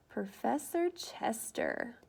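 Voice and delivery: normal talking voice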